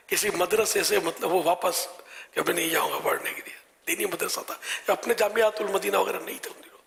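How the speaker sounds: usual speaking voice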